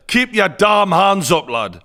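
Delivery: loud, urgent corner yell; coach shouting. Retold or imitated. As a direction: bark it like a cornerman. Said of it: "it's gone from".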